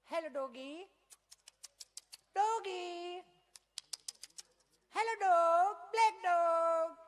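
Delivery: put-on high, loud, slow baby talk; fancy city tourist calling the puppy; cooing tourist. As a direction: coo at the dog like a pet lover. Fashionable tourists cooing at dogs.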